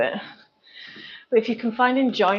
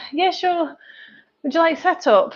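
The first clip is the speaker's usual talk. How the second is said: higher-pitched Scottish woman; Scottish accent; 7 semitones higher than usual. Retold or imitated. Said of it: "some Scottish woman, she got me with her accent".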